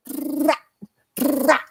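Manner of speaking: very high rolled trrrah sounds; high trilled punch noise. Retold or imitated. Boxer miming his all-out third round for a friend, high trilled noises. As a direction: mimic punches flying.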